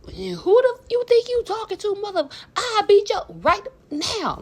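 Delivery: high, loud furious threat; angry yell. Retold or imitated. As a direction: yell it like you're ready to fight. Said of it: "I was like".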